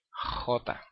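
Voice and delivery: "J" as jota, opening with a long rasp; scraped velum jota. Shown as a demonstration. Teacher demonstrating the sound for students, throat-scraped jota. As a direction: scrape the soft palate into "J". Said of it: "raspando el velo".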